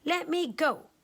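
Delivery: crying out. Acted. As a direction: beg to be let go. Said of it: "The Nian Gao cried".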